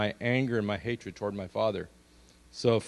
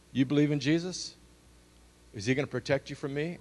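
a hint of the threatening father, pitched higher; mock the dad daring his son, a touch menacing; 3 semitones higher than usual.

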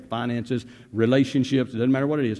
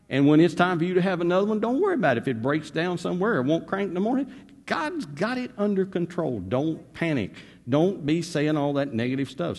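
full-force preaching; 6 semitones higher than usual. Acted. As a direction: drive it home to the congregation.

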